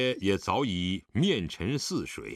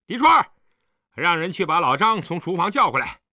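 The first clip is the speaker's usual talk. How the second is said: loud, high shout for a servant; 高声叫喊; 6 semitones higher, 8 dB louder than usual. A narrator's reading. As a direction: call out across the house at full voice. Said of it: "高声叫道". (扯开嗓门高声喊人)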